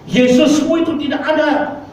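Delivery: angry woman shouting down the old man, high hammed-up yell; woman screaming. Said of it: "Wanita ini berteriak".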